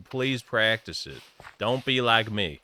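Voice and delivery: usual speaking voice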